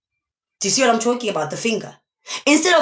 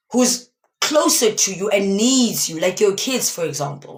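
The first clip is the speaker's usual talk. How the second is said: ordinary talk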